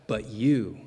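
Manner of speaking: "you" leaned on hard; stress on you. Retold or imitated. Jesus turning to his disciples, "you" emphatic. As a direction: put the weight on "you". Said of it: "The "you" here is emphatic".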